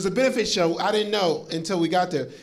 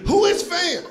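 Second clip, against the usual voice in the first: baffled question; 9 semitones higher than usual. Acted. Confused rant about fan jersey.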